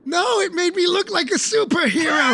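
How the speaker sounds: high-pitched outcry over the lost cloak; indignant protest. Acted. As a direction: wail that the cloak mattered. Protesting to Papa about cloak.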